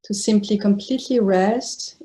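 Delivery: sparse phrases parted by long silences; guided meditation. Real, not acted. Teacher inviting meditators to release the body, spacious guided meditation. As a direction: let each phrase rest before the next.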